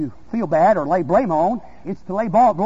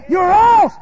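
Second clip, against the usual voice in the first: high, loud pronouncement; accusing shout; 12 semitones higher, 8 dB louder than usual. Acted. Preacher voicing the Holy Ghost to the doubter, accusing shout. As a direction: cry out the verdict.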